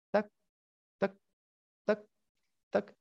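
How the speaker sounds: short, evenly spaced clock ticks; ticking second hand. Shown as a demonstration. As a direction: imitate a mechanical watch, one tick a second. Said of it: "vas a darte cuenta cuando el segundero está". (imitando el tictac del segundero)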